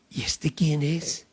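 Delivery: hushed, breathless whisper; frightened disciples murmuring to one another; awed whisper. Acted. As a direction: whisper it as if afraid to be overheard. Frightened disciples asking each other.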